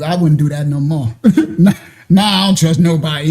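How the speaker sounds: ordinary talk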